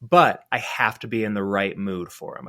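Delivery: normal talking voice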